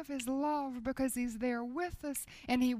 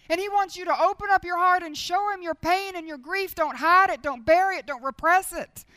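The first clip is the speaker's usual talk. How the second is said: loud, urgent pleading from the pulpit; forceful preaching; 6 semitones higher, 11 dB louder than usual. Acted. Preacher urging hearers not to hide pain, loud. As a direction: press the congregation hard, loudly.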